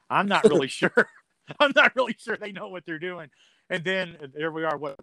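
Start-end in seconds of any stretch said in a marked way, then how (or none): 0.1-3.3 chuckling through the quote; laughing. Retold or imitated. Laughing as they doubt the new announcer. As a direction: laugh while doubting them. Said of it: "somebody's hotel room going".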